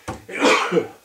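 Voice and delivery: sudden sharp sneeze; a real, unvoiced sneeze burst out; sneezing. Real, not acted. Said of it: "I have to sneeze".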